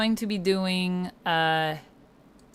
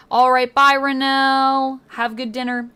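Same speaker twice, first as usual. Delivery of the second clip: calling out bye; 5 semitones higher, 8 dB louder than usual. Real, not acted. Calling goodbye to someone leaving.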